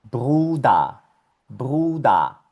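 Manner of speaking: "bruda" with long U, final ER as A; German pronunciation demo. Shown as a demonstration. Teacher showing how this word is read, clear "bru-da". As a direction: model bruda clearly, ending on open A. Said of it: "esto se lee".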